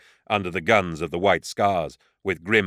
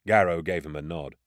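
plain reading voice

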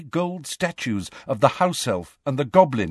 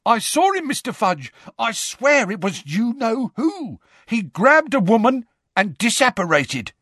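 excited; 10 semitones higher than usual. Acted. Excited Auror reports to Fudge.

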